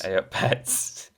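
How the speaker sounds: slow breathy Yorkshire greeting A up pets; echoing the fake Kojima message in a hushed Yorkshire accent; whispered mock Yorkshire. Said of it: "sounds a bit like Hideo's Japanese accent".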